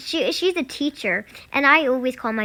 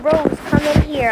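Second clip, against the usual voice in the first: calling out; 5 semitones higher, 7 dB louder than usual. Retold or imitated. Babysitter calling the kid.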